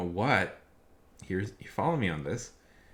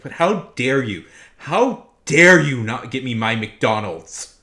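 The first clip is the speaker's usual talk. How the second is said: loud, slow, outraged customer parody; mocking entitled customer; 4 semitones higher, 12 dB louder, slower than usual. Worker mimicking a customer angry about McDonald's, loud slow mockery. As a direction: ham up the huffy customer, drawn out.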